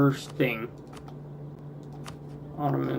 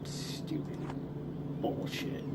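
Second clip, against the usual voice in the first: breathy whispered swearing under his breath; whispered curse; 15 dB quieter than usual. Real, not acted. Player cursing the game under his breath, whispered. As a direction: hiss it to yourself in disgust.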